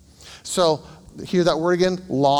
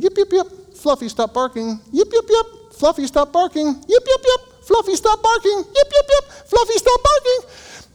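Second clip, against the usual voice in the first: dog and owner both getting higher and louder, ending very high; escalating yip exchange; 6 dB louder than usual. Retold or imitated. Owner and dog escalating each other, building to shrieks. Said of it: "Escalate, escalate".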